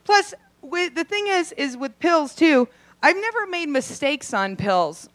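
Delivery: normal talking voice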